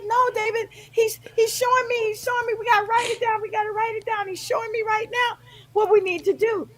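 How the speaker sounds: high-pitched excited insistence; insistent, pitched far above normal talk; urgent insisting. Retold or imitated. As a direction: reenact pressing a husband to act immediately.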